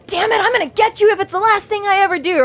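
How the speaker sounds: high, hammy cartoon villain vow; high hammed-up villain voice; cartoon coyote. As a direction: grit it out like a scheming coyote. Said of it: "the coyote is like".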